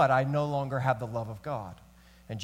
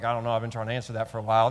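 rushed dismissive; 5 semitones lower, much faster than usual. Shown as a demonstration. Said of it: "Be like".

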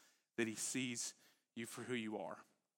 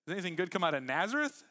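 scoffing; 4 semitones higher, 6 dB louder, faster than usual. Retold or imitated. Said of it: "And Nathanael goes".

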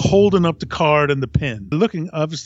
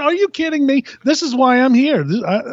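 excited disbelief; 9 semitones higher than usual. Retold or imitated. Superfan telling his coworker he's here for Kiss, worked-up and high. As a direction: insist like you can't believe the question.

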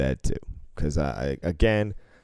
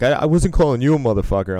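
higher, louder, fast protest; mock protesting denial; 8 semitones higher, 5 dB louder, faster than usual. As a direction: backpedal loudly. Retold gambler backpedaling.

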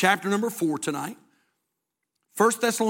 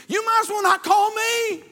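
high, raised preaching voice; emphatic retort; 10 semitones higher than usual. Acted. Pastor answering people who put off getting saved, high emphatic retort. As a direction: throw the answer back at them, pitched way up.

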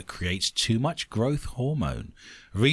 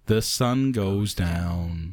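slow low echo; 8 semitones lower, much slower than usual. Acted. Echoing line in a low, slow voice.

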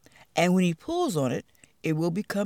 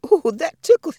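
giggly; 9 semitones higher, 8 dB louder than usual. Acted. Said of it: "he laughed".

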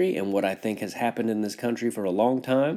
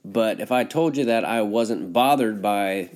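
usual speaking voice

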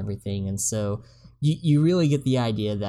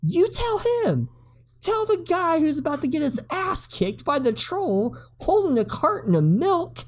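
much higher, exasperated mock retort; mock exasperation. Speaker retorting at the old lady, high mock outrage. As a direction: throw it back at her, incredulous. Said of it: "I'm just like".

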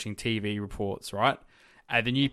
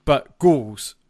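ghouls punched out louder; emphatic, louder "ghouls"; stress on GHOULS; 3 semitones higher, 9 dB louder than usual. Real, not acted. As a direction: hit the word ghouls hard. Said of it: "I wanna emphasize the word ghouls".

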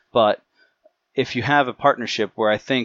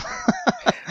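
high laugh. Real, not acted. Mocking friend's pronunciation.

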